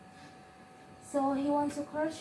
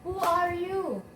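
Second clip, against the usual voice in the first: higher, louder challenge to a stranger; startled question; 5 semitones higher, 5 dB louder, slower than usual. Acted. Grandma to a stranger found in her home, startled. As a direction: demand it with a startled rise and fall.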